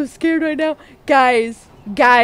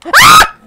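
very high, loud shriek; short burst of screaming; frightened scream; 14 dB louder than usual. Real, not acted. Player attacked in the game, frightened scream. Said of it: "I'm scared".

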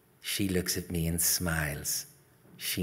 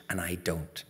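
plain reading voice

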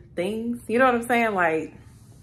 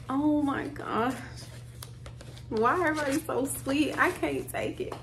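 tearful; 5 semitones higher, 6 dB quieter than usual. Real, not acted. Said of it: "I ain't got another tear to cry".

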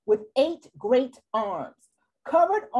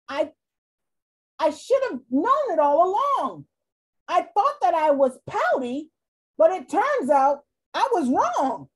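higher, brighter overjoyed fish; delighted fish; 4 semitones higher, 4 dB louder than usual. Acted. Kissed fish tells his friends, high and joyful. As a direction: sing out a new happy self. Fish cheered after kiss.

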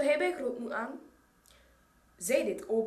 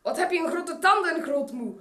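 loud and fast; 6 semitones higher, 7 dB louder than usual. A narrator's reading. Wolf and girl trading jibes.

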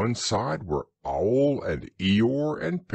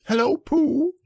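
much higher, squeaky little Roo; squeak; 6 dB louder than usual. Acted. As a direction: squeak it out like an excited baby kangaroo. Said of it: "he squeaked".

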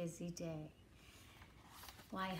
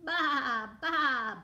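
loud, higher drawn-out baas; bleating sheep; 4 semitones higher, 6 dB louder than usual. Acted. Reader plays the sheep for children, loud bleating. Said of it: "bleated the sheep".

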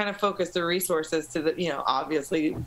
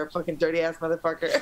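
ordinary delivery

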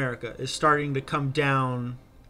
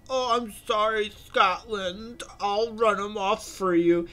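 higher, sheepish apologetic voice as Ireland; mock Ireland; 8 semitones higher than usual. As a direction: play Ireland sweetly apologizing to Scotland. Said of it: "And they're like".

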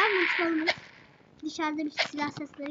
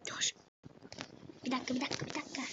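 hushed; 4 semitones lower, 11 dB quieter, much faster than usual. Real, not acted. Hushed while busy in game.

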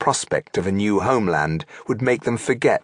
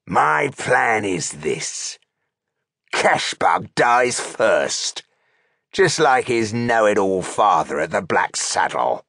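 slow, deliberate orc threat; menacing orc chieftain; slower than usual. A narrator's reading. Orc chieftain warning troops after killing the rebel, menacing. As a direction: lay it out slowly as a warning.

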